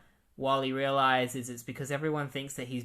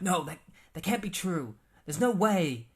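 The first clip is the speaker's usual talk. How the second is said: higher, louder, disbelieving cry; shocked denial; 5 semitones higher, 5 dB louder than usual. Retold or imitated. Wally refusing to believe his dad is dead, shouting in disbelief. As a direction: reject the news, voice rising to a shout.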